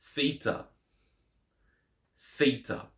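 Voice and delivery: Theta begun with unvoiced th as in thin, THEE-tah; hard th Theta. Shown as a demonstration. Teacher modelling Theta with hard th. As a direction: start Theta with the breathy th of thin. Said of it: "that hard T H sound".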